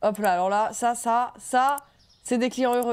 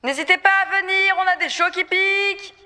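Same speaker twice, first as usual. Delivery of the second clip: calling out loud; 8 semitones higher, 6 dB louder than usual. Acted. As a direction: call it out to passersby. Shop owner calling out customers.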